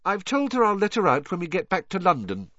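put-on high, pleased girl character; Hermione. Hermione announcing terms for the beetle, high and pleased.

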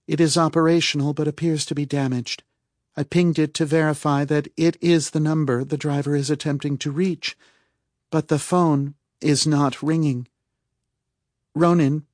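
high-pitched, even status report; Calpurnia reporting. A narrator's reading. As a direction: report the findings precisely, pitched well up. Narrator voices Calpurnia's damage report.

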